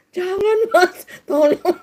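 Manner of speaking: high, whining fake protest; mock pleasure plea. Shown as a demonstration. Preacher mimics someone enjoying it while protesting, high mock plea. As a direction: wail a protest you don't mean.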